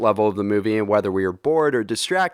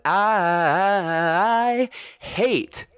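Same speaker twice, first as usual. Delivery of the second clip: exaggerated disgust; 7 semitones higher, much slower than usual. Real, not acted. Ranting about shaky cam.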